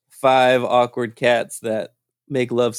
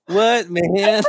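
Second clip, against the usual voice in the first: high, laughing "what, man"; laughing protest; 10 semitones higher than usual. Retold or imitated. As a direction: laugh it out in mock confusion. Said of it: "And I was like".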